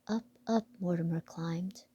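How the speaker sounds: ordinary delivery, nothing marked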